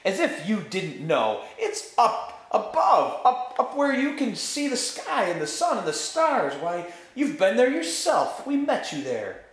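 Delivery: high, insistent arguing; exasperated protest. A narrator's reading. Scrub insisting to the witch the overworld exists, exasperated. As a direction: argue it urgently, pitched well up.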